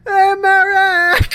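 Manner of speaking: high, loud, drawn-out comic holler; mock falling yell. Guy knocked back into the room, mock drawn-out yell. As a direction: yell it as if being knocked backwards. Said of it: "gets knocked back into that room and goes".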